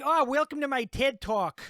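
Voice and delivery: mock Minnesota accent. Welcoming a TED Talk crowd, mocking Minnesota accent. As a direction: parody a presenter with a Minnesota accent. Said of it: "In a Minnesota accent".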